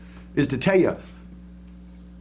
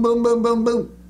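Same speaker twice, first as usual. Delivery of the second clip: imitated explosions; 8 semitones higher than usual. Imitating bombs.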